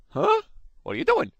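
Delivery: high-pitched puzzled yelp, then the question; Scooby-Doo. Retold or imitated. Stranger surprised by an unexpected good deed, cartoon-dog impression. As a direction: mimic a baffled cartoon dog. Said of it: "They sound like Scooby-Doo".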